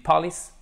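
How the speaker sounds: "police" as PAH-lis, short second vowel; "police" without its long ee; mispronounced short I. Shown as a demonstration. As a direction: put the stress wrongly on the start of "police". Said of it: "ça ne veut rien dire".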